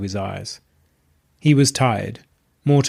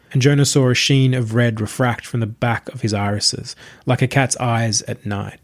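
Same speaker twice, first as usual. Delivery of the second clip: usual speaking voice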